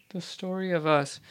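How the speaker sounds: soft, half-voiced, checking the title under her breath; reminding herself of the song name quietly; muttered to self. Real, not acted. Host checks title aloud before announcing, muttered.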